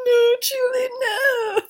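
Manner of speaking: high wailing mock sob; mock crying. Boyfriend mock-sobbing over dead girlfriend, high and wailing. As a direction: ham up a tearful boyfriend's wail.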